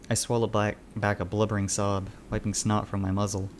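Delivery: ordinary delivery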